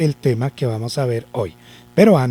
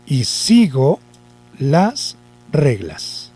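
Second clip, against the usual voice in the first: slow dictation; slower than usual. Shown as a demonstration. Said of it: "dictating slowly while writing".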